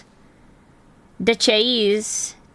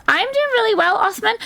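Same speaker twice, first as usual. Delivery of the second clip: much higher, squeaky reply in his voice; voicing a chatter; 12 semitones higher than usual. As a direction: answer for him in a silly squeak. Answering as a chatter.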